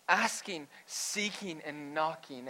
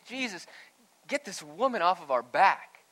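mock exasperated disciples, pitched up; annoyed; 3 semitones higher, 7 dB louder than usual. Disciples complain to Jesus about the woman, annoyed. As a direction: complain loudly, fed up. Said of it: "they're annoyed and they say".

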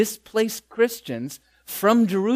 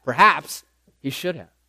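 ordinary talk

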